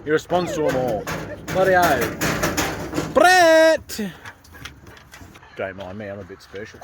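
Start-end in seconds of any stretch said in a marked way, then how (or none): 1.5-3.1 exasperated. Real, not acted. Drawn-out exasperated exclamation. 3.1-4.0 high, loud shout of the dog's name; calling the dog. Real, not acted. 5.6-6.8 self-mocking aside. Real, not acted.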